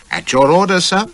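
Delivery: stiff, high and loud; answering Shelton's order stiffly; mighty stiff. A narrator's reading. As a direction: snap it back with stiff formality. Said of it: "says I, mighty stiff".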